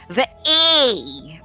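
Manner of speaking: "A" drawn out and high, then falling; long A demo. Shown as a demonstration. Teacher names learners' huge problem, long A stretched out. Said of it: "long A is a huge problem".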